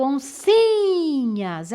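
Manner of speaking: oncinhas with a long, leaning SI; stress on SI. Shown as a demonstration. Teacher showing pupils the strong syllable, SI in oncinhas drawn out. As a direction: stretch the SI in oncinhas, then let the ending drop. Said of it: "o si eu falo mais forte".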